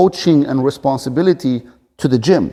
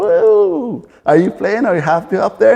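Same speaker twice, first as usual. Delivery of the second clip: put-on playful voice to a toddler; 9 semitones higher, 4 dB louder than usual. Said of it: "and I go".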